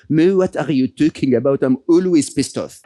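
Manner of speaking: imitated thick French accent. Podcaster quoting his monk friend's amused reply, French accent. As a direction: quote him in a French accent. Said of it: "in his very French accent".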